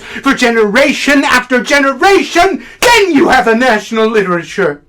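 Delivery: mounting, emphatic proclamation; rising fervour. Acted. Critic proclaiming what makes a national literature, rising fervour.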